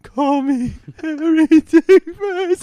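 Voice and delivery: very high, very slow voice breaking on the line; voice cracking into laughter. Retold or imitated. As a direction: let the voice squeak up and crack as the laugh breaks through. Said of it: "and I, my voice goes".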